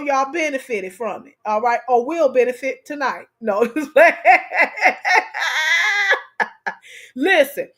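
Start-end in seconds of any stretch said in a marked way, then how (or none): 3.6-6.7 real laughing fit, cracking up; big laugh. Real, not acted. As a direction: burst into a long, loud laugh. Bursts out laughing on the live.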